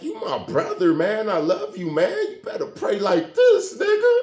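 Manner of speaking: high, loud, choked-up pleading; re-enacted crying outburst to a brother; retold tearful plea. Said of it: "And I started crying".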